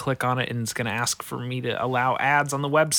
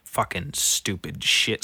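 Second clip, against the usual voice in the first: ordinary talk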